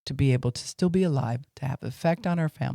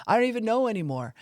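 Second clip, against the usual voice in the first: despairing; 9 semitones higher, faster than usual. Retold or imitated. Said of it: "That's why we'll say".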